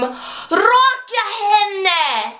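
high, long drawn-out stage scream; acted scream. Exchange student screaming her one line on opening night, drawn-out stage scream. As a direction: belt it out slowly and high, hammed-up. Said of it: "and I scream".

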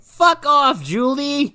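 high, put-on brush-off; mock dismissive friends. Friends refuse to help Julie, mock dismissive high voice.

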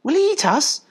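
high, nervous puppy question; rather nervous. A narrator's reading. Puppy asking mother about the farmer, rather nervously. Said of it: "said another rather nervously".

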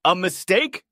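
high, loud disbelieving yell; a touch of a yell. A narrator's reading. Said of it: "Karen yelled".

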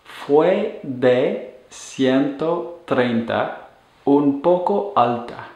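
slow, careful Spanish read for learners to repeat; slow model sentence. Shown as a demonstration. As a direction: say it slowly like a model answer. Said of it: "results with the verb ser".

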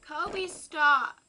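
loud sharp call at the dog; scolding the dog. Real, not acted. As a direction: bark a firm stop at the pet.

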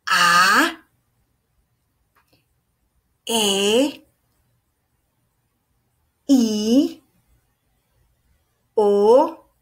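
each vowel A, E, I, O, U held long, singly with gaps; vowel demonstration. Shown as a demonstration. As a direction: model A, E, I, O, U slowly for repeating, rising at the end. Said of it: "Yo lo voy a decir y luego ustedes repiten".

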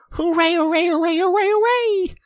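very high, loud run of cheers; jubilant cheering. A narrator's reading. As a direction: keep cheering in one wild string. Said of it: "Suddenly the rat cried".

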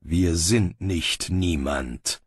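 low, very slow correction; calm authority. Acted. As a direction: put the guard in his place, low and deliberate. Bane overrules the guard. (ruhig und bestimmt)

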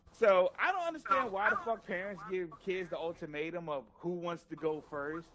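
ordinary talk, nothing marked

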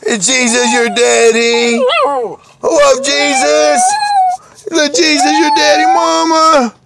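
high-pitched teasing questions to a dog; excited pet talk. Real, not acted. Excitedly talking to his dog.

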